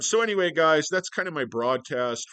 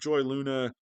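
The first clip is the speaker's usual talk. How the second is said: normal talking voice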